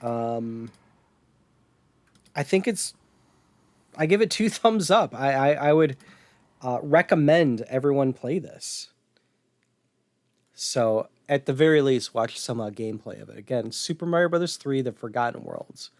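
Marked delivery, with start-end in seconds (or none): none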